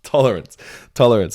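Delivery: "Tolerance" burst out loud and high, falling; eureka exclamation. Real, not acted. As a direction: shout "Tolerance" as the word clicks. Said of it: "That's the word I was thinking of".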